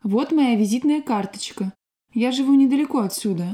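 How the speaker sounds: ordinary talk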